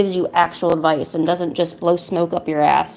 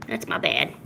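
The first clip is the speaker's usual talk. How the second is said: whispered apology; 7 dB quieter than usual. Real, not acted. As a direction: whisper the sorry. Apologising to cat.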